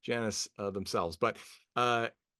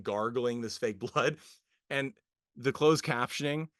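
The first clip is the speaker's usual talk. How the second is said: ordinary talk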